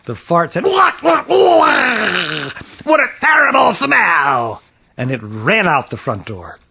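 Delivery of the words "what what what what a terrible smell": cartoonish yelps of disgust; disgusted outcry. A narrator's reading. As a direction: holler in revulsion at a stink. Fart recoils from rose.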